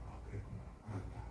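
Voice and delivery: softly, almost unvoiced, swallowing the anger; holding back anger. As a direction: keep it very soft and restrained, biting back the temper. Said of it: "꾹 참고 다 듣고".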